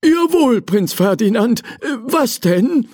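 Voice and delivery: high, dutiful eager reply; eager servant. Acted. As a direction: snap to attention. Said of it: "erwiderte Hugo".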